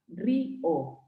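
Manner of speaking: "río" split as rí-o; broken diphthong. Shown as a demonstration. Teacher giving examples of a hiatus, río split into syllables. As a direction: separate the vowels of "río", stressing the í. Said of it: "el diptongo se rompe".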